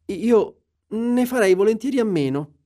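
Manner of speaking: high-pitched, hesitant demurral; falter, then decline in a high voice; nervous reluctance. A narrator's reading. Scrooge reluctant to meet the spirits.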